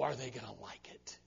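hushed, ominous whisper. Real, not acted. Preacher asks the congregation in a hushed, ominous whisper. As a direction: breathe the question like a warning.

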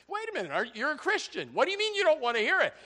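high-pitched, disbelieving questioning; incredulous challenge. Acted. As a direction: challenge them in rising disbelief.

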